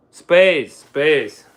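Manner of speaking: ordinary talk, nothing marked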